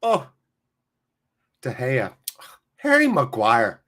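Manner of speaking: lightly voiced Irish pundit; Roy Keane. Streamer mimics a pundit despairing of players, light impression. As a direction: sigh the players' names like a weary pundit. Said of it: "you get Roy Keane".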